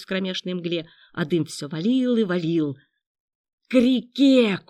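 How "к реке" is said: loud, higher-pitched order; commanding yell; shouted command. A narrator's reading. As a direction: call out the direction urgently. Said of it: "скомандовал Андрей".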